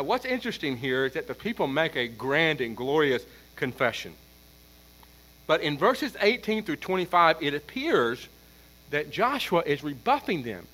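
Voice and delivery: ordinary talk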